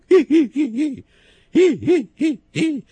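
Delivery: loud high whinnying hi hi hi; loud high horse whinny; horse neigh. Acted. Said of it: "Sagt das Kätzele".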